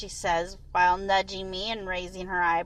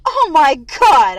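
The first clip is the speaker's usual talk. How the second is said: laughing; 5 semitones higher, 13 dB louder, slower than usual. A narrator's reading. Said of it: "I say as I laugh".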